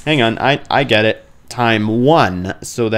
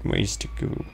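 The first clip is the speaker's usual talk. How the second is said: whisper; 5 semitones lower, 5 dB quieter than usual. Real, not acted. Gamer whispering at a creepy passage entrance. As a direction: whisper so the monsters can't hear.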